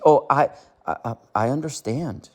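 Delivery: a soft, hesitant mock of his voice, dropped low and quiet; mocking Mark Ward. Mocking the man who called him stupid, soft mimicry. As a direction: lightly imitate how he talks, stammering. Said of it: "with his effeminate voice".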